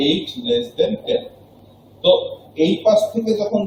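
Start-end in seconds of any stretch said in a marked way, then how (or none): 0.1-1.2 eight less than ten read slowly, pitch lifted; second slow model reading; slow classroom pronunciation; slow demo reading. Shown as a demonstration. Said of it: "আমরা দুইভাবেই উচ্চারণ করি".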